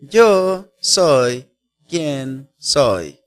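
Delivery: Yo soy quien soy, each word drawn out very slowly; solemn slow meaning. Shown as a demonstration. Preacher explaining the name to the congregation, very slow and solemn.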